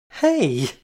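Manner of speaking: loud indignant "Hey!"; protest. Acted. Kitty protests as Jane laughs at her, loud. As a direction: cry out in protest. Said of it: "I protested".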